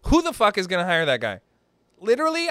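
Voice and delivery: normal talking voice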